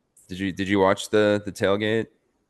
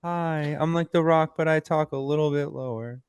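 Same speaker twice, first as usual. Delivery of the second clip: high-pitched take on The Rock; Mark Kerr; 9 semitones higher than usual. Acted. Podcaster trying out a costume voice for co-hosts, high impression. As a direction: introduce yourself in a very high put-on voice. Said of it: "I mean, higher, sorry".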